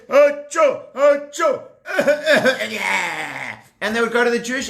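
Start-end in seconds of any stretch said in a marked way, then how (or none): 0.1-1.6 two high, loud mock sneezes; animals sneezing. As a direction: sneeze like sick farm animals. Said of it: "they would say".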